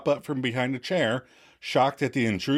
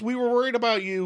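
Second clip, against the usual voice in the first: quick explanation; 11 semitones higher, faster than usual. Retold or imitated. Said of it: "quickly explains".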